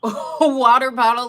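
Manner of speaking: laughing out the words. Retold or imitated. The niece teasing her aunt, laughing through the question. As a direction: giggle through it in disbelief. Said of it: "my niece is laughing".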